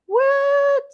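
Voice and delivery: very high, drawn-out squeal; shocked roommate. Retold or imitated. Roommate opening the suite door, high squeal of surprise. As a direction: squeal it long and high in disbelief.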